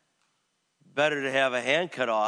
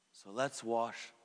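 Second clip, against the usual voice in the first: slow, much softer, lower invitation; soft closing call; 5 semitones lower, 11 dB quieter, slower than usual. Real, not acted. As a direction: gently end the sermon. Sermon's final exhortation.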